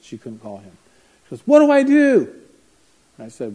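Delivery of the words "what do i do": very high, loud desperate cry; panicked plea. Retold or imitated. Single mom calling the counselor after the knife, desperate. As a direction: beg for help in desperation. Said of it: "she calls me in a panic".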